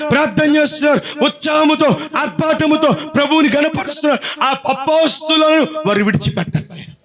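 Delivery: climbing into a high, shouting sermon cry; fervent preaching. Acted. Preacher to his church, fervent rising cry.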